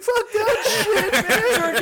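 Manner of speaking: very high, drawn-out comic wail; hammed-up high-pitched blubbering; mock crying like a baby. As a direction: whine it loudly like a bawling baby. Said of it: "fucking crying like a little baby".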